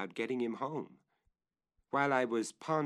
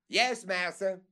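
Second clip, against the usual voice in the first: higher, louder reply; calling down; 10 semitones higher, 6 dB louder than usual. Acted. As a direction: answer from above.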